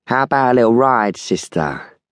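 mocking invitation, high then dropping on the last word; lightly mocking Americanized Cockney. A narrator's reading. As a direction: taunt her as the oily youth. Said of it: "he mocked her".

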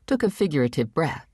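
usual speaking voice

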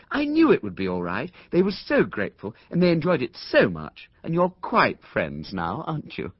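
light motherly fuss; fussy Mrs Brown. Acted. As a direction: coax the boy along, rising high and eager. Mrs Brown after the party.

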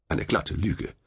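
usual speaking voice

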